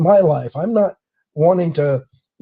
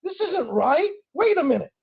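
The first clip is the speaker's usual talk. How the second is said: inner protest; 11 semitones higher, 5 dB quieter than usual. Retold or imitated.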